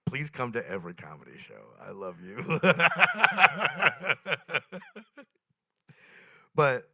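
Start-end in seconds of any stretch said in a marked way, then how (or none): 2.6-5.2 loud high burst of laughter; big laugh. Real, not acted. Comic cracks up at his own line. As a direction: laughs hard at own joke.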